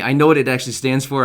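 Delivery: normal talking voice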